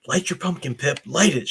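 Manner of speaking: ordinary talk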